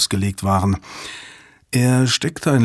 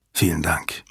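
whispered thanks; 7 semitones lower, 6 dB quieter than usual. Acted. David thanks quietly.

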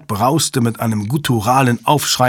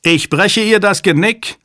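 high, loud desperate threat; shouted threat; 10 semitones higher, 5 dB louder than usual. A narrator's reading. Hale threatening the hostage's life, loud and high. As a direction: threaten loudly, voice pitched right up.

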